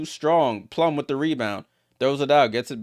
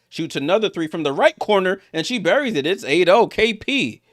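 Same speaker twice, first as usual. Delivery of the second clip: higher-pitched basketball call; excited play-by-play; 5 semitones higher than usual. Real, not acted. Commentator calls a three from the corner, excited. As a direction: build excitement as the shot drops.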